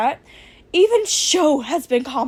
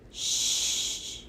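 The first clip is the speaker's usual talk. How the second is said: shushing; 9 dB quieter than usual. Acted. Character hushes the others.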